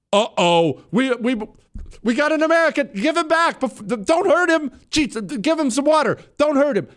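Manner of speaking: very high-pitched, panicky captor voice; hammed-up frantic alarm, stammering at the start; mock panic. Mimics captors scared of Americans.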